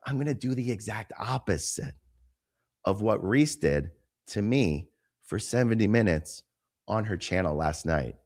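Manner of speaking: normal talking voice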